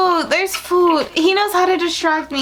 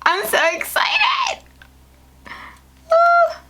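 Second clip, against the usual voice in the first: much higher squealed "so excited"; real giddy squeal; much slower than usual. Real, not acted. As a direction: let it burst out in a high cheer. Opening package, thrilled.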